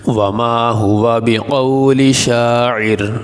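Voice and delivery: slow melodic tajweed recitation; Quranic recitation. Shown as a demonstration. Teacher recites the verse for the students, slow and melodic. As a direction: chant the verse slowly, drawing out each syllable.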